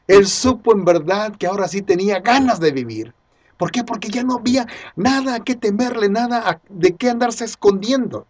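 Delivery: high, loud, jubilant sermon delivery; impassioned preaching. Real, not acted. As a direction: preach it with ringing conviction. Preaching at full force.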